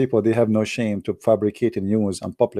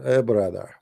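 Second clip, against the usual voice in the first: ordinary talk, nothing marked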